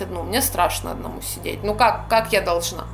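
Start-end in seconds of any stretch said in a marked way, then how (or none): none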